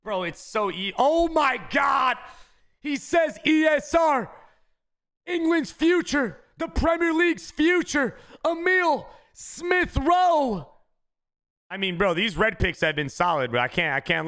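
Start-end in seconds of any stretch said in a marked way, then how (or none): 1.0-4.3 excited shout. Real, not acted. Excited pack reveal. 5.3-10.7 stretched, soaring hype call; hype reveal shout. Real, not acted. Hyped player reveal.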